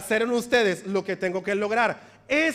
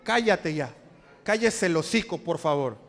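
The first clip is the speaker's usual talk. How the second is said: low playful hush, then a polite please; mock shushing; 6 semitones lower than usual. Shown as a demonstration. Preacher demonstrates the hushing line for the congregation, low teasing voice. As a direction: tell them to shut it, half-joking. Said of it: "Hazle así".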